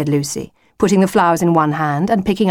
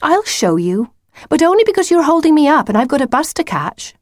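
put-on higher-pitched, put-upon girl; exasperated Lucy, grudging; 10 semitones higher, 4 dB louder than usual. Lucy with a bus to catch, grudging. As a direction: concede grudgingly, climbing high.

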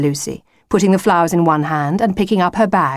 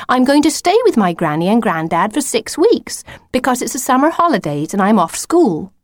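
lightly voiced girl, higher-pitched; matter-of-fact Lucy, explaining; 6 semitones higher than usual. Lucy explaining her holiday to the wolf, matter-of-fact.

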